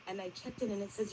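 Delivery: usual speaking voice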